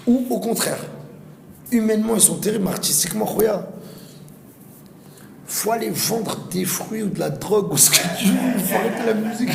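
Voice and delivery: high, scornful put-down; mocking scorn. Real, not acted. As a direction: sneer at the fake artists. Mocking bad-hearted artists.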